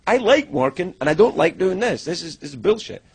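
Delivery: high, loud indignant comeback; retold protest. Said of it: "I'm like".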